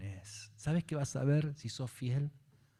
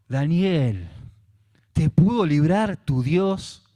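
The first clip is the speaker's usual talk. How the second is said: llamado del rey; 5 semitones higher, 8 dB louder, slower than usual. Acted.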